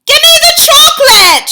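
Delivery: put-on high, loud demanding boy voice; shouting boy. A narrator's reading. Bruno shouting at the witch. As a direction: yell it like a suspicious kid. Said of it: "shouted Bruno".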